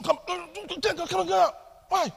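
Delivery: high-pitched, exasperated telling-off; acted exasperated dad outburst; irritated shouting father. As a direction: snap at the children for interrupting, voice shooting up high. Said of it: "I shouted at you".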